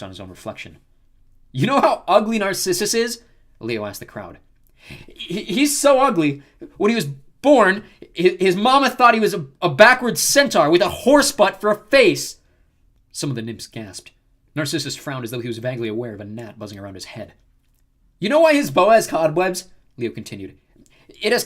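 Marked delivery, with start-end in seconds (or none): 1.5-3.2 high, loud joke setup; mocking crowd-work. A narrator's reading. Leo heckling, insult-comic style. 4.7-12.4 high, loud roast; mocking insult joke. A narrator's reading. Leo delivers insult joke to crowd. 18.2-19.7 high, loud joke setup; mocking crowd-work. A narrator's reading. Leo continues roasting Narcissus.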